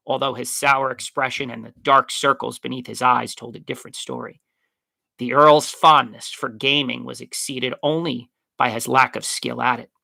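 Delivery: ordinary delivery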